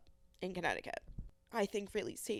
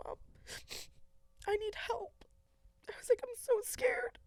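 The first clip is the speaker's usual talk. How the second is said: tearful plea. Real, not acted. Asking mom for help, tearful. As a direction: voice thin and high with tears.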